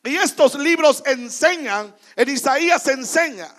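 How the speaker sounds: predica fuerte al pueblo, con fuego y alzando la voz; proclámalo como pastor desde el púlpito; predicación con fuerza. Acted. (predicador a la congregación, con voz fuerte y apasionada)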